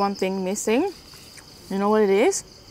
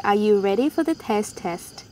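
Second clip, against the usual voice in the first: ordinary delivery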